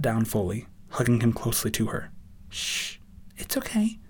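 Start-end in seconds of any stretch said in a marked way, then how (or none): 2.5-4.0 soft, high hush; high, gentle reassurance; soothing murmur. A narrator's reading. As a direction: murmur comfort to a crying child. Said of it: "she murmured".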